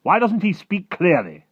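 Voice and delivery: slow, high, loud demand; snapping army chief. Acted. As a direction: demand it with loud military impatience. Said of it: "the head of the army snapped".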